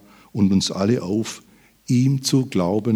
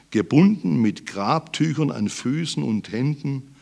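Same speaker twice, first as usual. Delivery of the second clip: normal talking voice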